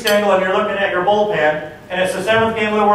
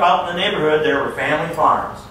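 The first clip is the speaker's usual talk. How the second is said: usual speaking voice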